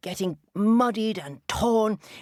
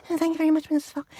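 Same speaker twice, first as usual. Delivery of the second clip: high, giggly little rabbit voice; giggle, then thank in a high voice; flattered rabbit; 10 semitones higher, much faster than usual. Acted. Missus Rabbit to the fox, high flattered giggle.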